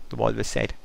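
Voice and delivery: ordinary delivery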